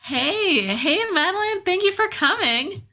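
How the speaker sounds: light up as a friend walks in; excited greeting. Real, not acted. Host welcoming Madeline to the stream, excited high greeting.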